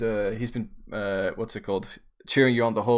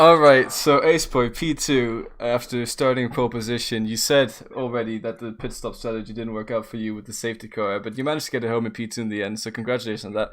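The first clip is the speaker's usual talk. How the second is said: louder, formal interview host voice; professional host; 6 dB louder than usual. Acted. Host resumes the post-race interview in a formal, louder host voice. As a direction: snap back to a formal broadcast tone. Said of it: "bring the professionalism back here".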